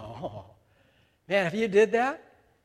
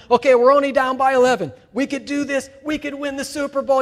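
higher, louder pep talk; rallying coach; 7 semitones higher, 9 dB louder than usual. Pretend. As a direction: fire the team up at halftime. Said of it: "the coach is trying to rally them".

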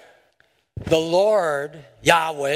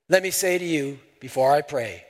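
ordinary talk

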